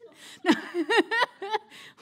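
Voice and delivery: loud real laugh. Real, not acted. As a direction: burst of high laughter. Laughs at own joke.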